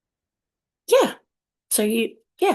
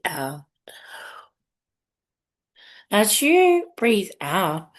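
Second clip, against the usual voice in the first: slow, measured count with long pauses; guided breathing; slower than usual. Acted. Host leads listeners through breaths, guided meditation voice. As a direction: pace each breath for the listener, unhurried.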